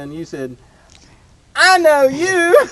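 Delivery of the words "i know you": high, slow, loud call of recognition; hollered greeting. Retold or imitated. Man calling from his truck at a stranger he recognizes, loud and high. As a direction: holler it out across a parking lot. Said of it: "this guy hollers at me out of his Toyota".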